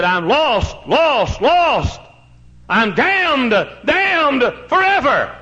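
a mock wail of the damned, rising; despairing cry. Dying infidel's cry retold.

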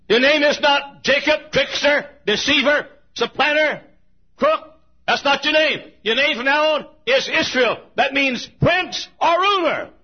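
high, loud, declaring each name; thundering proclamation. Acted. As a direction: proclaim it like God pronouncing judgement. God renaming Jacob, preacher thunders.

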